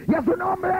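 high, shouted hype cry; hammed-up worship leader yell; mock worship leader. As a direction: whip the crowd up like a showy leader. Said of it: "es como ese, ese dirigente".

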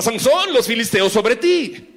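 high shouted warning. Acted. Dalila shouting to wake Sansón, a high alarmed yell. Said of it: "le grita".

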